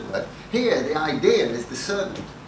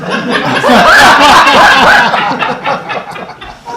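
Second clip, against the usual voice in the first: big high laugh held long; long loud laugh; 11 semitones higher, 17 dB louder than usual. Real, not acted. Mocking a former colleague to the audience, long loud laugh. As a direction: burst out laughing. Laughing about David Sable.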